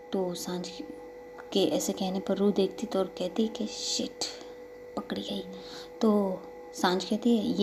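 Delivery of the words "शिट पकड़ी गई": softer, breathy muttered aside; a guilty kid murmuring to herself after getting caught; caught muttering. Acted. Child caught with ice cream. (पकड़े जाने पर धीमी फुसफुसाहट)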